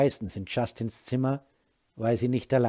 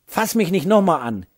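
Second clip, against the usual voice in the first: angry warning; 7 semitones higher, 9 dB louder than usual. Acted. Wife warns husband.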